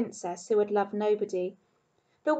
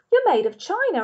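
higher, louder playful tease; playful man; 9 semitones higher, 5 dB louder than usual. Acted. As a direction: tease the toy gently. Bull to rabbit.

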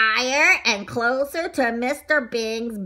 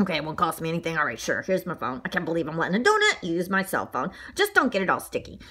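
low, quicker muttering man voice; grudging man character; 6 semitones lower, faster than usual. A narrator's reading. Man lends a donut his phone, low grudging voice. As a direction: mumble it low to yourself.

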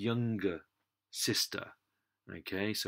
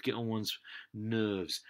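ordinary talk, nothing marked

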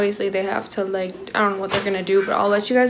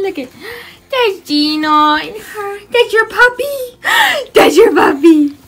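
high, delighted "That's your puppy" with gasps; 9 semitones higher, 5 dB louder than usual. Real, not acted. Cooing at baby and puppy.

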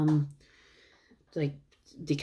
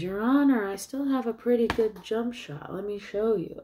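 higher-pitched retold character voice; voicing Davy; 7 semitones higher than usual. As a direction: lightly act the defendant's cocky plea. Voices Davy answering the judge.

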